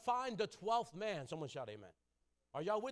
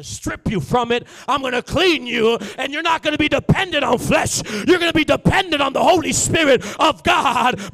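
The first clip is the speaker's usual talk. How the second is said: shouted preaching, climbing higher; preaching at full force; 5 semitones higher, 22 dB louder than usual. Acted. Preacher booming God's words at the congregation, shouting. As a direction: thunder God's promise to the church.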